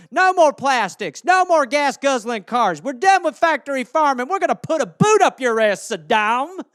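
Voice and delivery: loud, high battle cry; rally the troops against Saddam; mock rallying cry. Official vowing to fight climate like a war, loud mock battle cry.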